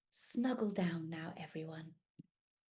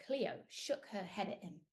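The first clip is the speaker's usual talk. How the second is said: ordinary delivery, nothing marked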